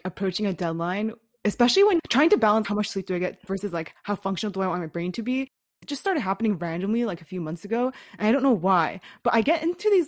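usual speaking voice

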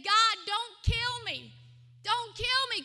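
loud, slow, desperate begging; a frightened plea for his life; pleading with God. Retold or imitated. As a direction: cry out for mercy. Terrified man begs God.

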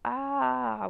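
loud high charging yell; battle cry. A narrator's reading. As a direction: roar as she charges. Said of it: "With a mighty cry".

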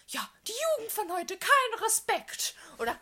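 hoher, langsamer Meckeropa; grumpy old man. Retold or imitated. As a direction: wie ein nörgelnder alter Mann meckern. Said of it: "der wäre dann safe so einer, der sich beschweren würde". (alter Griesgram mit hoher Stimme)

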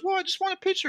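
put-on girlfriend's small voice. Girlfriend meekly asking her boyfriend for a picture. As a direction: plead in a tiny high voice. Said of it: "and she goes".

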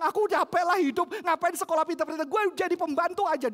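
loud, high exasperated complaint; preacher voicing a fed-up partner; quoting an exhausted spouse. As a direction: throw out the spouse's weary outburst. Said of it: "pasanganmu bilang".

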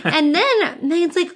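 normal talking voice

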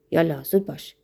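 gruff, impatient acted command; acted angry dismissal; menacing order. A narrator's reading. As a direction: hurry someone off with a threat in the voice.

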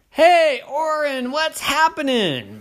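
very high, loud friendly hail; high squeaky hello; eager greeter. A narrator's reading. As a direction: call out to him cheerfully. Another greeter.

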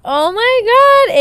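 high, loud, stretched-out oh my God; overwhelmed by the story's turn, slow loud Oh my God; amazed gasp. Retold or imitated. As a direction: gasp it out slowly, floored by the twist. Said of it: "And I was like".

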